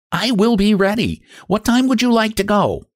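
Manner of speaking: high, cheerful piglet voice; little pig voice. A narrator's reading. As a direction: answer brightly as a small pig. Pig answers the wolf.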